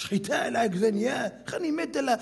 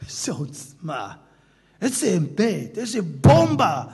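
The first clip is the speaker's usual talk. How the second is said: ordinary delivery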